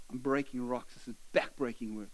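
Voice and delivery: low, tired grumble of a rock breaker; weary worker. Retold or imitated. Preacher voices the quarry worker breaking rocks, low and tired.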